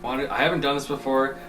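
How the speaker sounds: usual speaking voice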